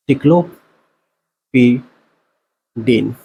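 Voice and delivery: very slow, broken into separate syllables; slow dictation. Real, not acted. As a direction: dictate it piece by piece, pausing between parts. Spelling out the answer slowly for students.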